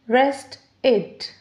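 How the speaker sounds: "rest it" as two separate beats, rest, ed; split into syllables. Shown as a demonstration. Teacher counting two syllables, "rest it" broken apart. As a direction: pause between "rest" and "it". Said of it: "So two syllables".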